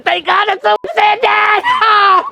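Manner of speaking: high, slow, loud relieved shout; relieved outcry. Retold or imitated. Relieved friend shouting in the boat, high and loud. Relieved outburst retold with laughter.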